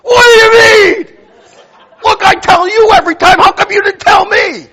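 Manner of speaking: laughing through a mock tirade; mock furious shout. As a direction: replay your outburst as a big comic shout. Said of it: "very nicely said".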